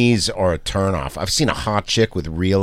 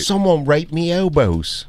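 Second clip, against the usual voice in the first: mock Manchester accent, lightly done; a hammed-up northern English lad; 5 semitones higher than usual. Riffing on a comedian, mock Manchester accent. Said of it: "he did a fucking Manchester accent".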